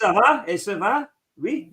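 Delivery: mock French accent. Host tries his French on the viewer from France in a mock accent. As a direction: ask it like a tourist showing off his French. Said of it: "You got a decent accent".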